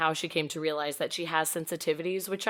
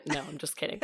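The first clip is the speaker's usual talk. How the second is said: normal talking voice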